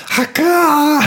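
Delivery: high, loud fake dad sneeze; big sneeze. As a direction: imitate a huge dad sneeze. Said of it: "admired my dad sneeze. He's like".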